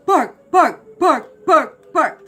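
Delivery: loud, high, repeated "bark" like a dog; imitated dog bark. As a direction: bark the word out in identical sharp bursts. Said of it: "he was barking, like, the same bark".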